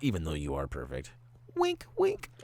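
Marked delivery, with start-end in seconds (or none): 1.6-2.3 squeaky wink wink. Pretend. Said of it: "joking that viewers are perfect".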